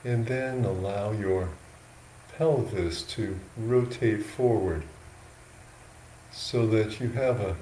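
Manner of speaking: slow, unhurried guidance with long pauses between phrases; guided meditation. Real, not acted. Monk guiding yogis into an upright sitting posture, slow meditation voice. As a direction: talk them gently into posture, a little softly, leaving space.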